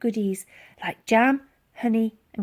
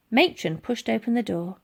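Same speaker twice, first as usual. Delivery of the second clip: usual speaking voice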